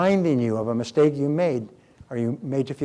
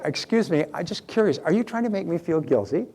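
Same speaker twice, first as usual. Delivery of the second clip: higher, gentle innocent question; mild curiosity; 4 semitones higher than usual. Shown as a demonstration. Person questioning a guilt-tripper, curious and non-attacking. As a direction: ask lightly, without attacking. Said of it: "You're not attacking them. You're just saying this".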